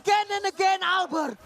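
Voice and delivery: caster yelling, pitch way up; hype shout. Real, not acted. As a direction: scream the play call. Caster hype on Albert's retribution.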